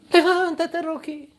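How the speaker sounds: high call that fades at the end; imitated wife's voice. Rocky's wife calling to fallen Rocky, high imitated voice. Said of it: "la esposa".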